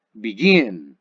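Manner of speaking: begin, second syllable punched and falling; stress on last syllable. Shown as a demonstration. Teacher repeating the verb for learners, begin stressed on last syllable. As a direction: lean hard on the GIN of begin. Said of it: "en la última sílaba".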